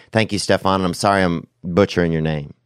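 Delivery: ordinary delivery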